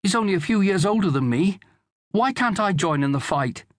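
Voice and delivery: put-on high, protesting child's voice; pleading boy. A narrator's reading. Son begging to join the fight, pleading. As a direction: plead like a lad left out.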